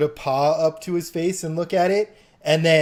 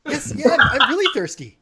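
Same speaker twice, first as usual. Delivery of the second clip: laughing gnome; 10 semitones higher, 7 dB louder than usual. Acted.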